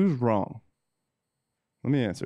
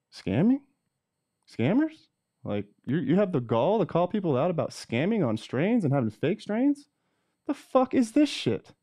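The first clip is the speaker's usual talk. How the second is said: high-pitched disbelieving rant; incredulous outrage; 7 semitones higher than usual. Real, not acted. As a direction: sound outraged and incredulous at the accusation. Mock outrage at being called scammer.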